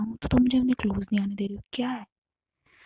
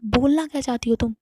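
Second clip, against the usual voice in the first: louder, higher, rising to a near-shout; husband confronting his wife, voice climbing to a near-shout; heated demand; 3 semitones higher, 6 dB louder than usual. Retold or imitated. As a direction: press her hard for an answer.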